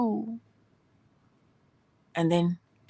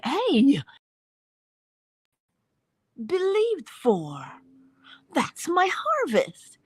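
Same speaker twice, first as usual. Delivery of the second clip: joyful, tearful discovery; 10 semitones higher, much slower than usual. Pretend. Believer sees their harvest.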